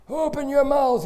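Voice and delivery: loud, high "Open your mouth"; loud command. Retold or imitated. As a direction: order the patient to open up, sharply. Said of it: "he says".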